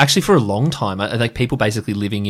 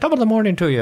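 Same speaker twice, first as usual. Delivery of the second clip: high, lilting stage Irish accent; put-on Irish greeting, pitched well up; 12 semitones higher, faster than usual. Shown as a demonstration. Showing the Irish part of the blend, high Irish accent. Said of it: "like, Cockney English and Irish".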